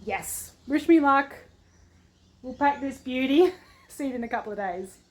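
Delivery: ordinary talk, nothing marked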